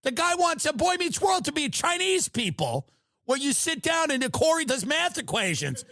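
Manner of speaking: high, loud, disbelieving yelling; incredulous rant. Real, not acted. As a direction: ramp up the outrage at the absurd idea. Incredulous rant about Chinese Friends.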